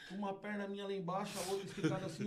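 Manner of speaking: ordinary delivery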